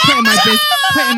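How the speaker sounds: high, loud laughing cry; laughing outburst. Real, not acted. Host embarrassed by friend's joke, laugh-shouting. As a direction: burst out laughing mid-word. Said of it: "laugh-cry outburst".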